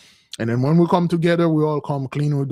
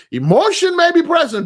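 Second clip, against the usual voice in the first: emphatic preaching; 14 semitones higher, 7 dB louder than usual. Real, not acted.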